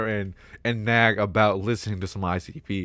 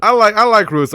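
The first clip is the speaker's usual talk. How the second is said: blurting it out louder, defensive; a fan snapping back at imagined comments, much louder and higher; sudden loud outburst; 8 semitones higher, 9 dB louder than usual. Real, not acted. Pushing back at commenters who say Roots is better, in a loud outburst. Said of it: "All right, my fault".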